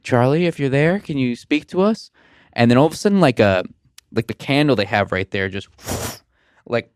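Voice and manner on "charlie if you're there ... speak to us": mock seance call. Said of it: "she's like".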